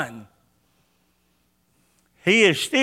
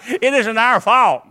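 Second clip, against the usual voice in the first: ordinary talk, nothing marked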